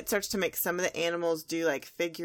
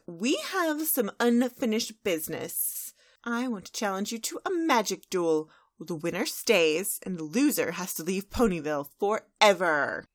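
Trixie; 3 dB louder than usual. Acted. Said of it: "Trixie's all like".

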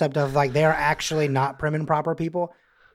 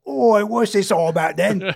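put-on loud, slow, drunken Cockney; old accent slipping out; 4 semitones higher, 5 dB louder than usual. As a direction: lay on a tipsy Cockney accent. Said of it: "that's my old accent".

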